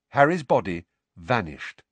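ordinary delivery

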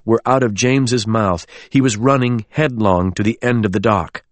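ordinary delivery, nothing marked

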